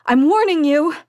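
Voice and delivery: high, very loud scream; woman screaming a furious warning. A narrator's reading. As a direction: scream it as a threat. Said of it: "unfamiliar woman's voice screaming".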